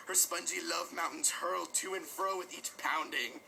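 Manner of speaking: ordinary delivery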